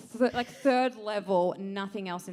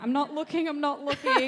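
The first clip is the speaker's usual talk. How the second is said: higher, louder imitation of an averted-eyes helper; flustered neighbour; 5 semitones higher, 6 dB louder than usual. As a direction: insist hastily, imitating an embarrassed neighbour. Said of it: "went like this and was like".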